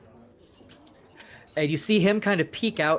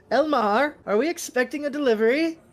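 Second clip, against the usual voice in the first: calling out; 10 semitones higher, 4 dB louder than usual. Acted. Clerk calling back to shopkeeper.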